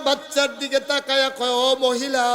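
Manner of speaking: loud, high melodic preaching; full-force sermon. Acted. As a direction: chant it out to the crowd, drawing out line ends.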